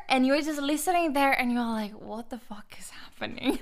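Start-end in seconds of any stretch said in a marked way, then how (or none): 1.9-3.6 hushed, whispered disbelief; stunned whisper. Retold or imitated. Client retelling her shock at the therapist's insight, stunned whisper. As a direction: whisper it like a shocked inner thought.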